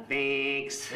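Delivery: Wisconsin accent honked like a buzzer; exaggerated Wisconsin accent. Shown as a demonstration. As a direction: blare it out in a broad Wisconsin accent. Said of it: "sounds like a game show buzzer".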